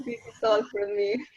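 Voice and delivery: normal talking voice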